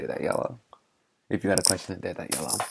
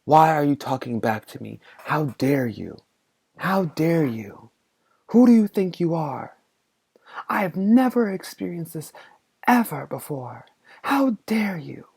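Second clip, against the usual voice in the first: much higher and louder hammed-up outrage; furious teacher; 7 semitones higher, 8 dB louder than usual. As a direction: scream down at the kid in mock fury. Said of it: "And she said".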